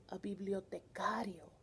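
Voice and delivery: hushed Spanish word; whisper. Real, not acted.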